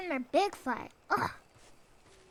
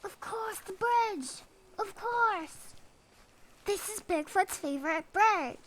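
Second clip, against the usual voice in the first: high, bright "of course!"; excited realisation; 6 semitones higher than usual. Real, not acted. Gamer realising the bridge is the key, excitedly high. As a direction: hit on the answer and crow it.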